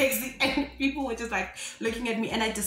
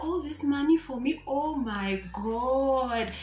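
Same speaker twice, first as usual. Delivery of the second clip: high, drawn-out mock delight; imitated cheering-up stranger; 6 semitones higher, slower than usual. Nigerian passenger handed taxi fare, exaggerated high delight. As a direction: play amazed at the money, rising very high. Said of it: "genuinely trying to cheer me up".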